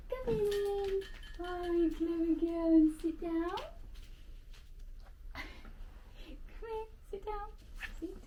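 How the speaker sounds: calling the dog. Real, not acted.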